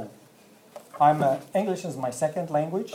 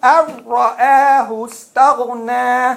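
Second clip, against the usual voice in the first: Quran recitation; 7 semitones higher, 7 dB louder than usual. Shown as a demonstration. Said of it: "read it all together".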